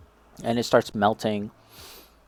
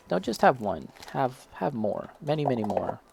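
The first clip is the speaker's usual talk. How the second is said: packaging tempting voice; 7 dB quieter than usual. Retold or imitated. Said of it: "It's just inviting you to say".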